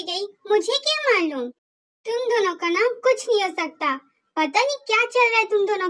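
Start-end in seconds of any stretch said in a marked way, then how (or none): none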